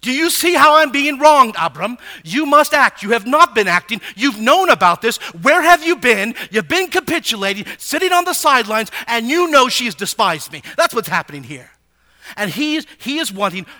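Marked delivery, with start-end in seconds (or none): 0.0-10.6 loud, high, emphatic grievance hurled at a husband; wronged wife crying out to Abram; forceful complaint. Acted. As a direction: demand justice at full voice. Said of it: "she is asking Abram to make judgment".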